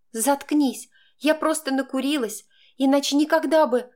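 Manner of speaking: high, heated outburst; angry self-defence. A narrator's reading. Girl shutting Max up and excusing herself, angry.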